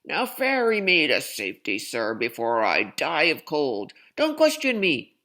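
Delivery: loud furious bark; barking boss. Acted. Circus boss barking angrily at the captain. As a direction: snap orders at the captain. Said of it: "barked Mister Payne".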